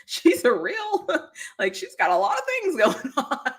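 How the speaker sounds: slow, high, laughing "Woo"; mock listener laughing. Imagined listener teasing the ranting host, laughing. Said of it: "anybody listening to this and going".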